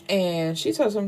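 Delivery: ordinary talk, nothing marked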